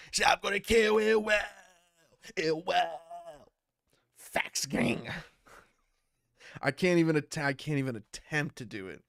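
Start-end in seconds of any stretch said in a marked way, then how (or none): 0.1-5.1 higher shouted put-on voice; Snake Eyes impression. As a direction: shout it in a gruff rapper voice. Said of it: "Snake Eyes impression".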